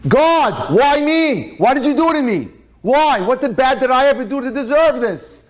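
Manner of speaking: retold screaming. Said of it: "he starts screaming".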